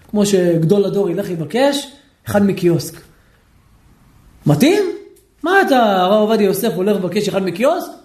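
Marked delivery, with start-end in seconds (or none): none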